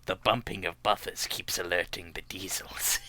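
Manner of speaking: unvoiced whisper. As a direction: whisper like sneaking around. Whispered sneaking bit.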